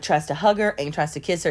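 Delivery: normal talking voice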